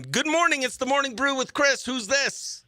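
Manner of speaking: high, bright on-air DJ greeting; radio host intro. Acted. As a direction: open the show like a morning DJ. Radio show opening bit.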